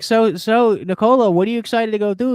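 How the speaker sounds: a high, loud eager interviewer voice; mock reporter. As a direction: ham up a pushy reporter's question. Mock reporter asking champion.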